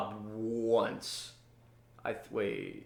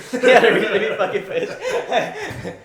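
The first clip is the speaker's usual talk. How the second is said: loud, higher, drawn out through laughter; laughing hard; 7 semitones higher, 9 dB louder, slower than usual. Real, not acted. Host cracks up over his own cop story, laughing. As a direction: repeat it while cracking up.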